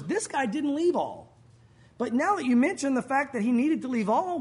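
normal talking voice

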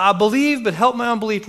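high, urgent outburst in one rush; acting a desperate father crying out. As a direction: blurt the plea, pitched high. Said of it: "In times of desperation, the father cried out".

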